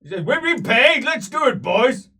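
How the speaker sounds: dwarf slayers rallying for the hunt. Acted. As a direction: rouse the boys to go.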